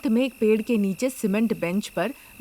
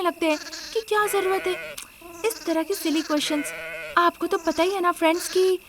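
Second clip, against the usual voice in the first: spoilt rich bride; 7 semitones higher than usual. Acted. Anamika confides to friends about stealing sheep, high and sly. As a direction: let the audience in on your secret.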